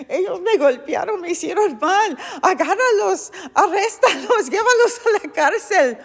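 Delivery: loud, high mock outrage; indignant accusation. Preacher pretends to be Paul demanding arrests, hammed-up indignation. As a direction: cry out for their arrest as if wronged.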